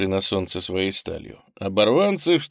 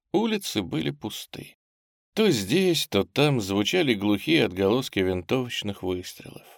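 usual speaking voice

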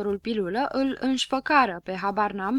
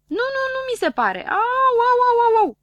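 high, loud string of ows; howling in pain; 9 dB louder than usual. Acted. Dunno howling at the doctor over the splinter, high and loud. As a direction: yelp as if being cut. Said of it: "de ce țipi de parcă te-aș tăia".

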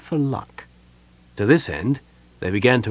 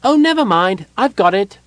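high, loud, breezy kender voice; cheerful kender; 10 semitones higher, 6 dB louder than usual. A narrator's reading. Kender inside the tomb, cheerfully high and breezy. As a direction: toss it off cheerfully. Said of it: "he heard the voice say cheerfully".

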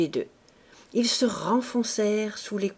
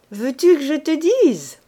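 put-on gleeful young wife; 8 semitones higher, 9 dB louder than usual. A narrator's reading. Wife sprawling in a stolen bed, loud and gleeful. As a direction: bounce on the bed and crow about it. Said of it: "reprit-elle".

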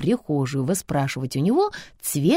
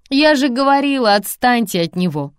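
higher, louder exasperated cry; exasperated call; 6 semitones higher, 6 dB louder than usual. A narrator's reading. Mother leaning into the hallway, exasperated call. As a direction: call out, fed up.

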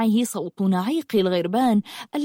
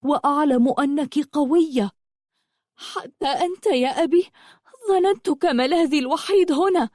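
high-pitched, wounded daughter; hurt reproach; 6 semitones higher than usual. Acted. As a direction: reproach a father, voice raised in pitch. Hurt daughter reproaches her father. (عتاب ابنة مجروحة بنبرة عالية)

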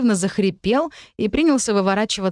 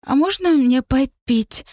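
higher, slow pleading pixie voice; plaintive squeak; 6 semitones higher, slower than usual. Acted. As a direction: squeak it out pitifully like a tiny fairy. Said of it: "жалобно пропищала фея".

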